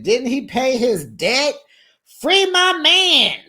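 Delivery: high, loud mock protest, building; hammed-up outcry. Crying girlfriend protests her man's jailing, hammed-up outcry. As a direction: wail it like a dramatic rallying cry, stretched out.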